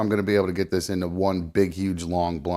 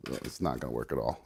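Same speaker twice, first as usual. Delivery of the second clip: much softer, lower muttered repeat; muttering; 10 semitones lower, 14 dB quieter than usual. Real, not acted. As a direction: mutter it under your breath. Repeats failure to himself.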